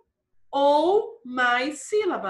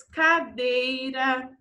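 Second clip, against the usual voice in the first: long drawn-out syllables; slow dictation; much slower than usual. Shown as a demonstration. Teacher giving pupils a diphthong example, slowly dictated. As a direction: spell it out by sound, slowly.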